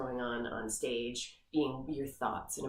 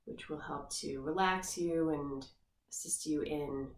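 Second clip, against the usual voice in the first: guided meditation. Acted. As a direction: ease the listener into the body scan gently. Leads guided body scan meditation.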